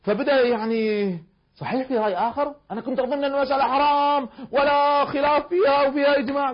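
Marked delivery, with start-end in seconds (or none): 1.5-6.5 higher and louder, astonished; surprised. Retold or imitated. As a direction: sound amazed that another view exists. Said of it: "هو بالنسبة له مفاجأة". (دهشة ومفاجأة)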